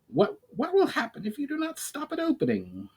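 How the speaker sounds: high, worried goblin voice; a nervous goblin, pitched well up. A narrator's reading. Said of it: "responded Valinar".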